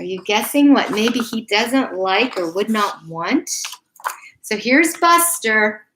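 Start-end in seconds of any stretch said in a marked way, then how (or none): none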